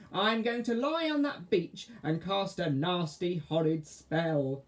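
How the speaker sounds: high, slow wicked witch; cackling witch. Acted. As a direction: relish each word like a witch. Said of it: "she cackled".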